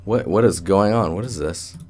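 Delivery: ordinary talk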